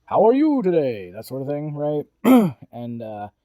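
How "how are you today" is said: put-on high, loud old-time radio host; 1940s show voice. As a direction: greet like a 1940s radio announcer. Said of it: "the classic, like, nineteen forties show voice".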